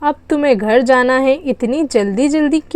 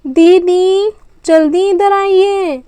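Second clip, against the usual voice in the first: calling out; 5 semitones higher, slower than usual. Acted. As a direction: shout across the park to get her over. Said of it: "इतना क्यों चिल्ला रही हो".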